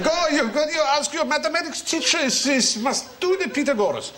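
a lightly hammed Hungarian grandfather. Grandfather urging young Fry, in a hammed Hungarian accent. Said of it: "He said".

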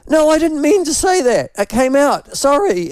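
high flustered retelling. Retold or imitated. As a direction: panicky backpedal in a high voice. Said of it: "and I went".

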